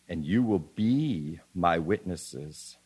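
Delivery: "be" held long and set apart; stress on "be". Shown as a demonstration. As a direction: lean slowly on the word "be". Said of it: "I emphasize the word be".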